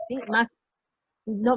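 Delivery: ordinary talk, nothing marked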